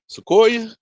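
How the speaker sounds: a high, loud call across the yard. Real, not acted. Calling the cat Sequoia from across the yard, high and loud.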